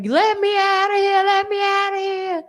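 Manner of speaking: high, desperate plea to be let out; frantic cellmate. Retold or imitated. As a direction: beg like a panicked inmate. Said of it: "So she was like".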